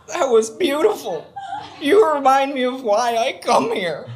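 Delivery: high, slow mock-tearful voice; tearful, moved. Inspired spectator thanks the couple, tearfully moved. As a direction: imitate a man choked up with emotion. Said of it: "tears in his bright blue eyes".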